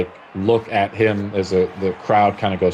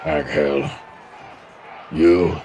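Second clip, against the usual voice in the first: hulking orc commander; 4 semitones lower, much slower than usual. Acted. GM voices Commander Dench.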